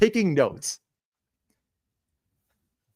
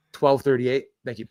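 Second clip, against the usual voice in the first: normal talking voice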